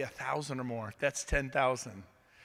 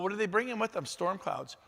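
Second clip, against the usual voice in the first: ordinary delivery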